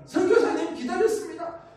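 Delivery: loud, high-pitched mock-delighted greeting; hammed-up welcome of a long-awaited guest; imagined eager welcome. Chairman imagined grasping the missionary's hand, exaggerated eager welcome. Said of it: "손을 딱 잡으면서".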